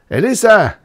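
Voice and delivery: high, loud shout of a name; impatient rough shout; shouted summons. A narrator's reading. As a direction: call upstairs angrily. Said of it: "he shouted".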